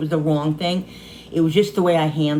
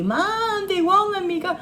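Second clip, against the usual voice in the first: high, distraught mock sob; high crying daughter's plea; imitating daughter crying. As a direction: voice the daughter hysterical on the phone. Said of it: "Crying, hysterical, hysterical, crying".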